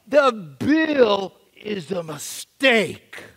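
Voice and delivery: slow, spaced-out plea to a machine; slow insistent plea; slow deliberate plea. Retold or imitated. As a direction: spell it out slowly for the computer. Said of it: "all I wanna do is to say".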